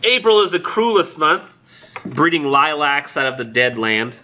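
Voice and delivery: measured poetry recital, high opening easing down; poem reading. Acted. Lecturer restarting the poem for the class, poem reading. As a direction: read it out as verse, deliberately phrased.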